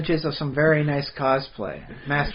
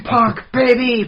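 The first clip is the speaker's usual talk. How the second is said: playful hype; 9 semitones higher, 5 dB louder than usual. Pretend. Joking cosplay banter.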